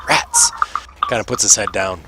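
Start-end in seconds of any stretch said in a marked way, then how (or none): 0.1-0.5 put-on squeaky kid. Kid disappointed.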